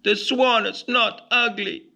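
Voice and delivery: a little bird listing who rejects him; tiny-voiced duckling, hurt and alone; sad ugly duckling. A narrator's reading. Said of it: "he says".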